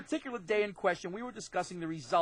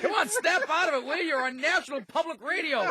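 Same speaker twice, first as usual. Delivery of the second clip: mock scolding; 11 semitones higher than usual. Real, not acted. Scolding laughing brother on air.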